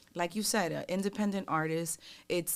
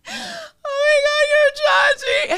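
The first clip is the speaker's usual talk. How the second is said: loud, very high tearful wail, dragged out; imitated bawling; 17 dB louder, slower than usual. Fan recognizing her idol downstairs, bawling. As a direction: mimic an overwhelmed fan bursting into tears. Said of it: "she just starts bawling".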